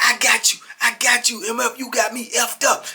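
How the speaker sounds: heated imitation of a threat; retold angry threat. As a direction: voice an enraged man promising payback. Acted enraged officer's threat.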